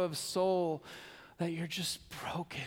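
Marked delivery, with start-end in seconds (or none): 1.4-2.6 breathy whisper of brokenness. Acted. Pastor to listeners about being broken, whispered. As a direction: whisper it like a confession.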